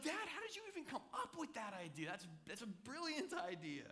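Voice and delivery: high, amazed kid voice, imitated; amazed child. Son amazed by Dad's new Lego idea, high excited imitation. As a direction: mimic a son who is stunned by his father's idea. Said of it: "he was blown away".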